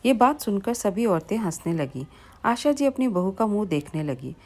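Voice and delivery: ordinary delivery, nothing marked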